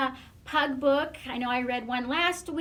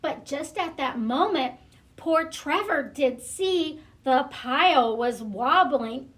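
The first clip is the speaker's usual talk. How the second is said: usual speaking voice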